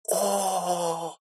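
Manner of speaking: протяжное высокое «О!»; тянуть со значением; многозначительно. A narrator's reading. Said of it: "многозначительно протянула она". (многозначительно протянуть)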